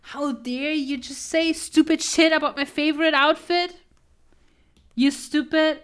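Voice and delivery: loud, offended friend hamming it up; mock outrage. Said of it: "everyone is just like".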